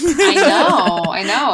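high laughing admission. Real, not acted. Game master cracking up at the players' antics, laughing. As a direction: laugh it out, caught off guard.

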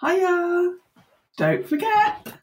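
high, sing-song greeting from grief; grief's cheery voice. Retold or imitated. Guest voicing grief as a cheery high sing-song reminder. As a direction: sing it like a sly visitor dropping by. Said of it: "always just waiting to sort of go".

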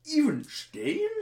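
high, slow, wide-eyed question; amazed infomercial customer. Acted. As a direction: stretch it out in awe.

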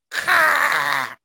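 high, harsh bird call; raven caw. Acted. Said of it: "My skeleton raven just goes".

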